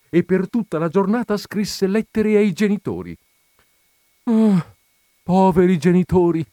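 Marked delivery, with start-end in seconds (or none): 4.3-6.4 sighing pity. Acted. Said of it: "sospirò la colonnella".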